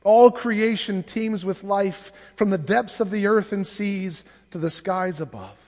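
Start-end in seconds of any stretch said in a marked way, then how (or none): none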